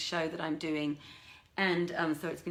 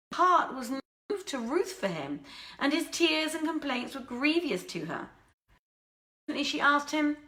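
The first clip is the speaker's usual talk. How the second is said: story narration; 6 semitones higher than usual. A narrator's reading. Narrator reading the Arabian Nights live to listeners, expressive storytelling. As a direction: read the old tale with expressive rise and fall.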